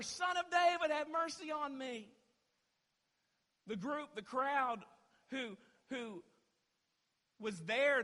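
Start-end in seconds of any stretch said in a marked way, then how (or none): 0.0-2.1 high, loud unbroken plea; insistent crying out. Acted. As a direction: keep shouting over those who silence you. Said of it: "he kept crying out all the more".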